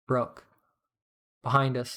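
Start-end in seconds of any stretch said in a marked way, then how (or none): none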